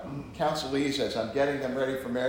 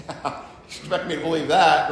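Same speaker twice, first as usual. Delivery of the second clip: knowing look, laughing; 5 dB louder than usual. Retold or imitated. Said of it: "gives that knowing look".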